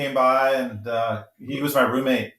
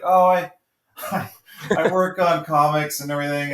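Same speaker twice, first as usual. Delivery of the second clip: modest laughing Mike; 3 semitones higher than usual. Retold or imitated. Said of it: "He's like".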